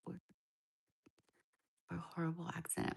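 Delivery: drops to a whisper on the hard words; softly whispered out of tact. Real, not acted. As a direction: voice the sensitive words under the breath. Reading a sensitive viewer question.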